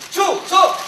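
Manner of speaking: high loud salute shout; korean military style. Real, not acted. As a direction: snap it out like a soldier. Soldier salutes loudly.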